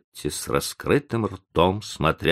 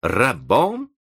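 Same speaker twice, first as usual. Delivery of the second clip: raised, indignant echo; losing his temper; 6 semitones higher, 3 dB louder than usual. A narrator's reading. Professor throws the accusation back, losing his temper. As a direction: throw the word back, higher and rising. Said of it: "вышел из себя профессор".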